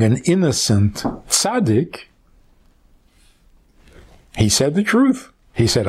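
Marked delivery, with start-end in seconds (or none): none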